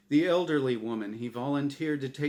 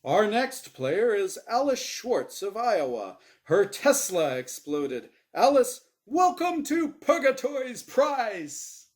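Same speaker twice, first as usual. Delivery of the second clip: game show host; 8 semitones higher, 6 dB louder than usual. Acted. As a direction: stretch it out for the audience. Host introduces next player.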